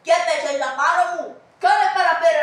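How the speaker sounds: loud irritated rebuke; angry scolding. Acted. Servant scolds the old man who bumped him, loudly.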